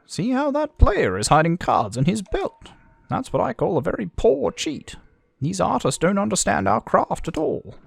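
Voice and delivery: high-pitched character voice; high, theatrical game dialogue. Acted.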